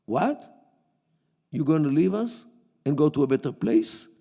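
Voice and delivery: ordinary delivery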